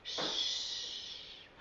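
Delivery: soft, drawn-out hushing hiss; long shush. Acted. Friend hushes caterpillar while metamorphosizing, long shush. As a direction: hush them with a long breath.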